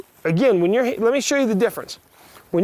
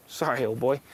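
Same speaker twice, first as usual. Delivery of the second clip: soft apology to horse; 8 semitones lower, 7 dB quieter than usual. Real, not acted.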